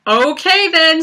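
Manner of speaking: high, loud, bright okay then; pleased surprise. Retold or imitated. Told the staffer can buy tickets, pleased surprise. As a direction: pop it up in happy relief. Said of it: "I was like".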